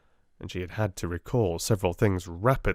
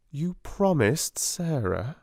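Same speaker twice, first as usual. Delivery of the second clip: slow, tender, slightly higher; gentle reminder; 7 semitones higher, slower than usual. A narrator's reading. Sarah soothing the child.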